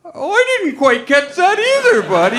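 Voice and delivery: high cartoon dope voice; Goofy. Deaf puppet missing the son's words too, Goofy voice. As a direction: apologize as a dopey puppet mascot. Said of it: "Goofy's like".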